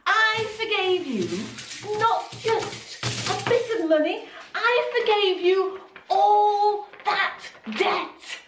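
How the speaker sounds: furious king. Acted. King rebukes the wicked servant, furious and loud. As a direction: thunder it down at the servant.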